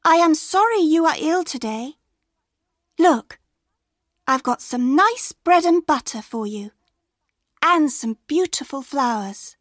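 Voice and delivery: put-on young girl's voice. A narrator's reading. Girl offering bread to her sick grandma, sweetly high. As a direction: play the small girl bringing gifts. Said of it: "girl visiting sick grandma".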